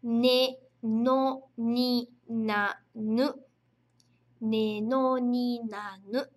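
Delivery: ねのになぬ very slow, each syllable apart; teacher sounding out the kana row slowly; slow reading drill. Shown as a demonstration. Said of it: "Como é que a gente vai ler?".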